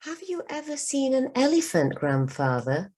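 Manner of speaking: put-on high, curious child voice; little girl asking. A narrator's reading. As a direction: ask it bright and eager like a young girl. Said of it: "said Anjali".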